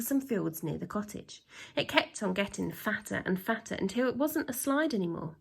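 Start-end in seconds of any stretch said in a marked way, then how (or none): none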